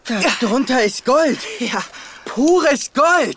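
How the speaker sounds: laut und hoch vor Begeisterung; begeistert. Acted. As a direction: den Goldfund jubelnd verkünden. Finds the gold treasure. (lauter, hoher Jubel über das Gold)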